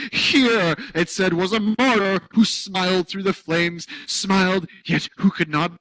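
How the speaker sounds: oversell the martyr's saintly suffering; hammed-up martyr. A narrator's reading. Reader mocking a saintly face, hammed-up.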